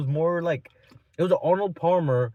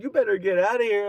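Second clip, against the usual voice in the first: raised, higher mock-angry warning; pissed-off woman; 6 semitones higher, 5 dB louder than usual. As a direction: imitate her telling you off. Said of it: "She was pissed".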